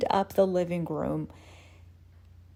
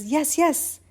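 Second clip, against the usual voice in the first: high, eager agreement; pitched-up, quick double yes; excited; 8 semitones higher than usual. Acted. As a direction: jump in with delighted confirmation. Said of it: "so excited".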